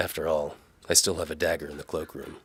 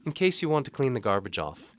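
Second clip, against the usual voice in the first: higher, louder lightly voiced young woman; Galena's voice; 9 semitones higher, 7 dB louder than usual. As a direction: voice the maid brightly and politely. Galena teases.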